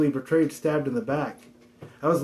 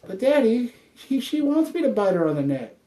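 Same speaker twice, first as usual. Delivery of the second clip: pet voice for kitten; 6 semitones higher than usual. Pretend. Voicing the kitten to daddy.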